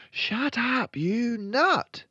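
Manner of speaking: put-on loud, high little-girl scolding; high-pitched cross girl; scolding Matilda. A narrator's reading. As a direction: snap at the bird as a small girl.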